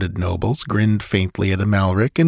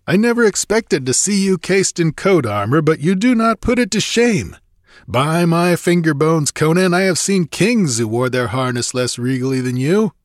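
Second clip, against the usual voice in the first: high-pitched soldier voicing admiration; impressed mercenary captain; 8 semitones higher than usual. A narrator's reading. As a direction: pay a rough comrade sincere tribute. Captain marvels at armored Conan.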